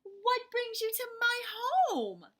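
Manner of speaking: shouted greeting. Acted. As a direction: call out to the officer. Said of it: "Amu shouted".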